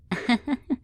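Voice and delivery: short, high, rising giggle; light teasing laugh; amused chuckle. Acted. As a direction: chuckle at a helpless captive. Amused at the bound captive.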